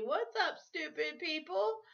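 high, drawn-out intro with rising end; channel catchphrase. Acted. Vlogger demonstrating her future channel's intro, sing-out catchphrase. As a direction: stretch out a high-pitched channel greeting. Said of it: "her future channel will actually be".

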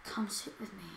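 soft, low creepy man; creepy, drawn-in quiet invitation. Retold or imitated. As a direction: imitate a creep, hushed and low. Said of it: "This guy sounded so creepy".

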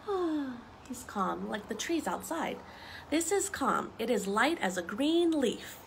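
usual speaking voice

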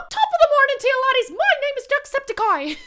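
loud high Irish greeting; Irish accent. Acted. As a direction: shout it like an Irish YouTuber. YouTuber intro impression.

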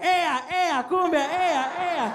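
high, rhythmic cheering chant; egging on. Acted. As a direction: imitate cousins goading him along. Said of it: "just encouraging the walk".

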